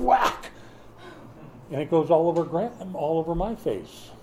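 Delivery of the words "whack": high, louder sound-effect whack; excited whack. Retold or imitated. Father telling how the baby swatted the spoon, high loud whack. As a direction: punch it like a cartoon hit.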